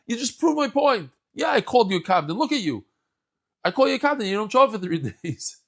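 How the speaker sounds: triumphant and amused; laughing retort. Acted. [name] laughs back at Elijah.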